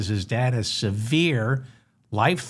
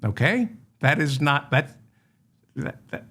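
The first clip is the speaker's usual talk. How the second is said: ordinary talk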